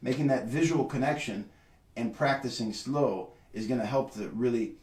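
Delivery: ordinary talk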